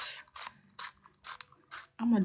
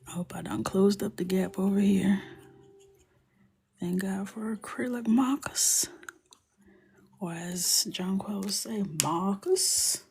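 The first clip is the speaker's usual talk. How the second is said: whisper; 3 semitones higher than usual. Acted. Whispering to viewers while coloring with markers. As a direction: whisper the steps like an ASMR tutorial.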